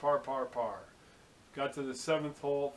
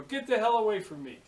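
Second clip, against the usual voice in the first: higher and louder, shooing him off; louder retold yell; 7 semitones higher, 6 dB louder than usual. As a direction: shout him away, half joking. Repeats it, louder.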